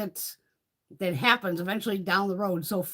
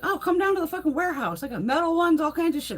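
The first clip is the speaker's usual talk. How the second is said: eager seller voice; 8 semitones higher than usual. Retold or imitated. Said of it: "He's like".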